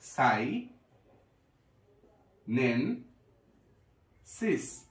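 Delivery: slow, piece by piece; broken into syllables. Shown as a demonstration. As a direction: spell it out syllable by syllable. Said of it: "ভেঙে ভেঙে পড়ি". (ধীরে, ভেঙে ভেঙে)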